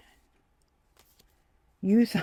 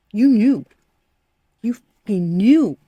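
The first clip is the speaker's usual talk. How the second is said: emphatic; 7 dB louder, slower than usual. Real, not acted. Emphatic reading to viewer.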